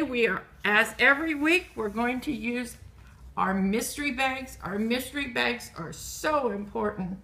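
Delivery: ordinary talk, nothing marked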